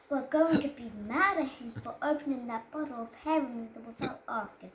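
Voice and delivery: ordinary delivery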